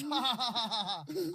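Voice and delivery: loud, hammed-up fake laugh from a rich guy; mock billionaire laugh. Billionaire laughing off the comedian's joke, mock fake laugh. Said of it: "And he goes".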